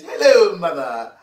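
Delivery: a hint of a posh Queen's English greeting; a lightly mocked British greeting to mother; posh English accent. Showing how he talks to his mother, mock posh English. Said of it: "I am speaking the Queen's English".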